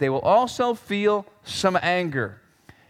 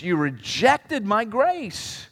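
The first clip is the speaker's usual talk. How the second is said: very slow, higher-pitched reproach; drawn-out accusation; 4 semitones higher, much slower than usual. Acted. God to Jeroboam over rejected grace, slow accusation. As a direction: drag out each word in wounded accusation.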